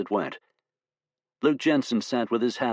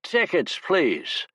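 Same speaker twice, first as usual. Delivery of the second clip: put-on sloppy drunken conductor yelling; drunken shout; 9 semitones higher, much slower than usual. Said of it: "a drunken voice shouted".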